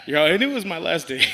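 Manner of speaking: loud, high laughing quip; loud joke through laughter. Real, not acted. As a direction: crack up while saying it. Joking reply while cracking up.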